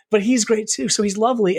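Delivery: normal talking voice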